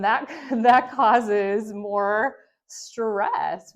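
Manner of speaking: slow, forceful, worked-up delivery. Shown as a demonstration. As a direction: stretch it out loudly to act out mounting stress. Demonstrating how a raised voice stresses.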